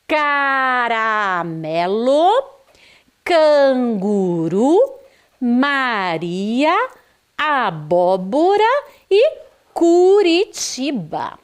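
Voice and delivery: bem devagar, cada vogal esticada; leitura silabada. Shown as a demonstration. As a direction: leia cada palavra em sílabas alongadas, para alunos. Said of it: "Vamos ler?". (leitura lenta e cantada de professora)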